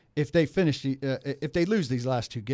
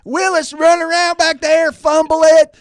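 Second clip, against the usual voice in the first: higher, louder, slow fan yell; loud fan rant; 13 semitones higher, 9 dB louder than usual. Pretend. Impersonating fans' demands.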